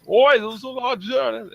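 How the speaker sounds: high, slow street-thug yell; drawn-out cockney oi; rowdy goon shout. Acted. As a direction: shout it like a rough street urchin. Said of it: "you just hear the, the ruckus".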